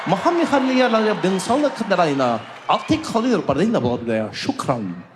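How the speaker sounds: fast pseudo-Arabic safety video, swooping pitch; mock Arabic gibberish. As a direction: pretend to recite an Emirates video in made-up Arabic. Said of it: "the video comes on".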